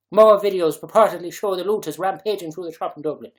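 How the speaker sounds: put-on loud Dublin accent read. Streamer reading looters report, loud mock Dublin accent. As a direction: proclaim it in an animated Dublin accent. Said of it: "put Dublin accent into".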